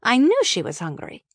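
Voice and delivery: ordinary delivery, nothing marked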